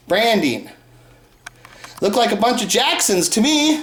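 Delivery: normal talking voice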